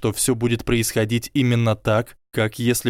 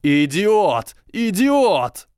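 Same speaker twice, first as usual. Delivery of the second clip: climbing, ever higher screams of rage; yelling; 9 semitones higher, 4 dB louder, much slower than usual. A narrator's reading. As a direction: shriek it louder each time. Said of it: "заорал Драко".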